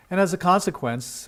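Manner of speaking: ordinary talk, nothing marked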